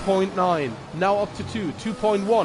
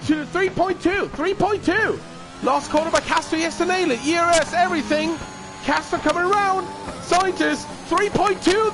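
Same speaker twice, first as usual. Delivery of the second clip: motorsport commentator; 9 semitones higher than usual. Real, not acted. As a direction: call the final lap at full energy. Final lap commentary, high energy.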